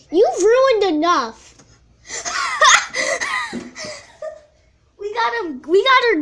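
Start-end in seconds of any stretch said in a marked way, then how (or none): 2.1-3.9 gloating laugh. Real, not acted. Prank succeeded, gloating.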